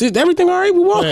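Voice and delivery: put-on high, incredulous old-timer quote; lifting the voice like neighbors checking in; imitating grandpa. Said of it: "And he's like".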